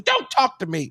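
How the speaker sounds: high-pitched mock snapping; angry rebuff. Angry girl rebuffs the bandleader, imitated high anger. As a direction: imitate someone too furious to talk.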